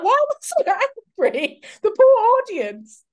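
high, loud words broken by real laughter; laughing. Real, not acted. To S1 about her stiff early video, laughing hard. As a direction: laugh through it, almost squealing.

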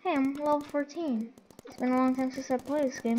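normal talking voice

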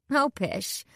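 airy, playful dismissal. A narrator's reading. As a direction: wave it off gaily. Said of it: "Piper replied gaily".

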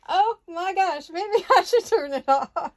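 high, slow words through giggles; can't stop giggling. Real, not acted. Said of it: "a case of the giggles".

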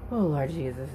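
normal talking voice

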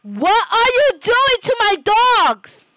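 high, loud outraged cry; high shout. Real, not acted. Shouting at Maru over the dogs, high outraged shout. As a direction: shriek at the pet to stop.

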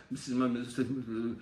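rapid, slurred "Mississippi mud". Shown as a demonstration. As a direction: race the phrase into a blur. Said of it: "if I go faster and faster".

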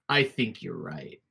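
low, slow dragon threat; booming dragon voice. Acted. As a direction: rumble it, patience running out. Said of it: "loud booming laughter".

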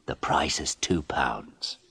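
slow secretive whisper. Acted. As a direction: let him in on a secret deal. Photographer lowers price secretly.

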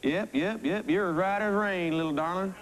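put-on cornball country accent. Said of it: "this cornball accent".

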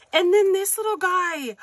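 high, loud burst of delight; delighted squeal. Real, not acted. Delighted by gift item.

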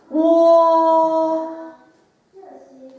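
"おー" lips pushed forward, then opening gradually up and down; drawn-out "おー", not the Japanese お; Chinese o vowel. Shown as a demonstration. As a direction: round and push out the lips for a long "おー", slowly widening. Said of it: "唇を前に出してください".

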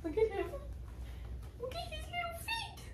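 very high squeaky gushing; overwhelmed by cuteness. Real, not acted. Gushing over baby's feet.

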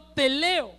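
"Teleo" proclamado con fuerza contenida; un leve grito triunfal; grito de victoria moderado. Pretend. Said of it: "Jesús gritó". (un grito triunfal en la cruz, algo contenido)

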